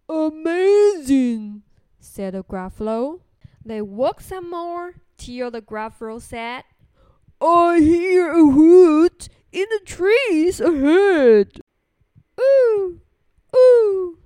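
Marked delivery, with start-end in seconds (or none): 0.1-1.6 loud, drawn-out monster voice; amazed Gruffalo. Acted. Gruffalo sees Snake flee the mouse, amazed and booming. 7.4-11.6 loud monster voice; big Gruffalo. Acted. Gruffalo hears something in the trees, booming. 12.4-14.2 two high, loud hoots; owl hoots. Acted. Storyteller imitates Owl in the trees, hooting loudly.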